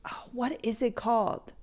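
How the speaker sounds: unvoiced muttered question; a puzzled whisper; whisper to self. Real, not acted. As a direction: whisper it to yourself, searching for the word.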